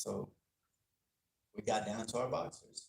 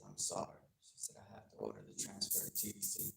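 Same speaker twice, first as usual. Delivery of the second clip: tearful judge; 4 semitones lower, 8 dB quieter than usual. Retold or imitated. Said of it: "made her cry even more".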